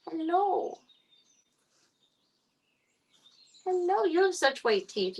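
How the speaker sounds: high pet-talk greeting; cat talk. Real, not acted. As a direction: coo up high to a tiny cat. Cooing at the foster kitten.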